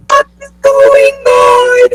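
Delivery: squealing high and slow; high mock shout. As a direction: shriek it like panic. Everyone suddenly leaving call.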